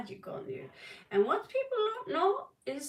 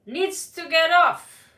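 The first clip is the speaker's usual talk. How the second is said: slow, building to loud; building emphatic; 6 semitones higher, 13 dB louder, slower than usual. Acted. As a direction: build up slowly to a loud finish.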